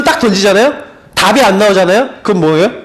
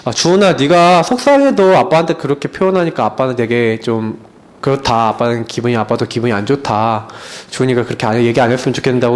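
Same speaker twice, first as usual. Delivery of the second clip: gentle fatherly talk; 8 semitones lower, 3 dB quieter than usual. Retold or imitated. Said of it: "그래서".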